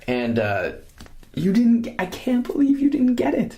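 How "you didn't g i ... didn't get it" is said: high-pitched disbelief; scandalized, rising high; incredulous. Real, not acted. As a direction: squeak out your disbelief. Mock disbelief at co-host.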